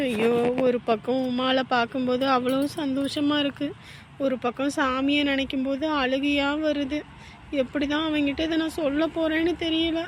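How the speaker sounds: hushed, anxious talking to oneself; worried aside. Acted. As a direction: fret softly, as if thinking aloud. Anxious private aside, near tears.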